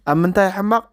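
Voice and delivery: usual speaking voice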